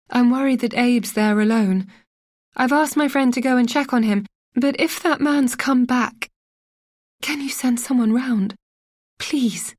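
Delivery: mild mock panic, begging for help; understated hysteria on the phone; growing hysterical. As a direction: voice rising, pleading and panicky. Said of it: "getting hysterical".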